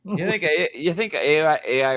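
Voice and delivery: high, loud disbelief; you can't be serious; incredulous. Real, not acted. Incredulous question.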